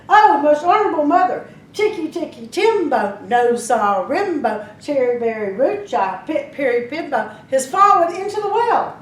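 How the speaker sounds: high, loud, breathless calling out; urgent call. Acted. Little boy calls to his mother, loud and urgent. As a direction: call the long name up to mother in a rush.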